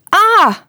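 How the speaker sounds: put-on sharp high yelp of a boy who trips; boy stumbling, crying out in pain. A narrator's reading. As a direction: let out a sudden high "Ah!" of pain. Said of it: "roept hij".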